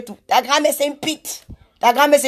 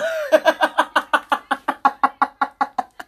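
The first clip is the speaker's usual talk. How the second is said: long run of staccato laughter; long laughing fit; 3 dB quieter than usual. Real, not acted. Laughing at misunga joke, long real fit. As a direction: keep laughing until it fades.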